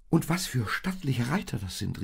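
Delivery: high, admiring exclamation; exclaiming. A narrator's reading. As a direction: cry out in excited admiration. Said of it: "rief Maria aus".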